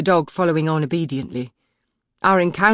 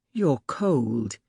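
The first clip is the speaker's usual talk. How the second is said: put-on lightly lower, softer young courtier; a hint of a quiet young man, kind; young man, gentle; 3 semitones lower, 4 dB quieter than usual. A narrator's reading. Young man's lines in the story.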